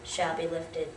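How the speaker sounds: low, subdued, measured verse; hushed poem recitation. Acted. Student closing The Raven for an audience, low solemn recitation.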